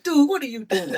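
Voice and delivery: a high, loud "dude, what are you doing?"; friend's high call. Retold or imitated. His friend at the lottery store, a high baffled shout. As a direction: imitate the buddy's baffled shout. Friend sees him digging for gum.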